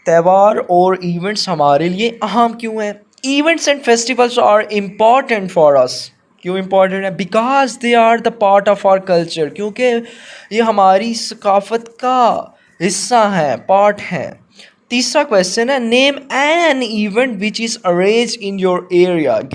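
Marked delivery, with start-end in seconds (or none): 3.3-5.9 slow, deliberate dictation pace; slow classroom read-aloud. Shown as a demonstration. Teacher reads model answer slowly. 7.2-9.5 slow, deliberate dictation pace; slow classroom read-aloud. Shown as a demonstration. Teacher reads model answer slowly. 15.8-19.5 slow, deliberate dictation pace; slow reading aloud. Shown as a demonstration. Teacher reads question slowly.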